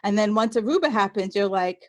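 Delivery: ordinary delivery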